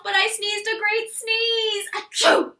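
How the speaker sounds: high, loud hammed-up achoo; big loud sneeze. Wolf sneezing on the pig's house. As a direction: let the sneeze explode.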